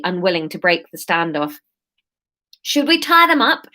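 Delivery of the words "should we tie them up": higher-pitched questioning character voice; topsider asking. A narrator's reading. One of the topsiders asks about the captives, pitched up. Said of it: "she heard one of the topsiders ask".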